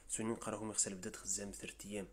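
usual speaking voice

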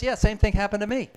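a client eagerly sharing the same story; excited reply. Retold or imitated. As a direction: burst in with your own story.

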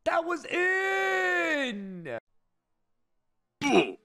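slow outraged yell; drawn-out furious shout. Real, not acted. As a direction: stretch it in protest. Disputed goal.

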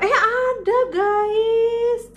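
delighted squeal of discovery, pitched high and loud; a sudden happy yelp at finding it; surprised. Real, not acted. Vlogger discovers the sharpener was there, excited high squeal. Finds sharpener she thought missing.

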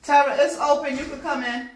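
calling out loudly downstairs; yelling across the room. Real, not acted. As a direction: raise your voice to reach the door. Calling client from upstairs.

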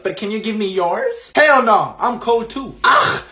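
usual speaking voice

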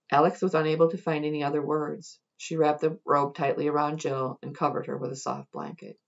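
usual speaking voice

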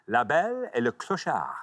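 relished French title read; French-accented announcement. Acted. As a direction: say it with French flair. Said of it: "a French accent, though, don't you".

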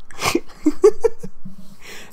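high wheezing giggle; breathless laugh. Real, not acted. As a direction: laugh at your own slip. Reader laughs at her misreading.

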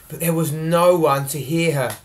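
plain reading voice

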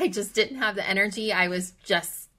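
ordinary delivery

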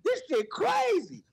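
high, slow mock disbelief; retelling his own stunned reaction. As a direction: let the shock stretch out. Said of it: "I'm like".

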